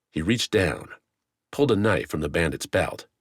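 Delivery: ordinary delivery, nothing marked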